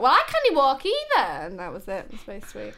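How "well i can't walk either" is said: high, loud punchline retort; mimicked retort. Doctor in a wheelchair answering the boy, high loud retort. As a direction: toss the comeback out high and loud.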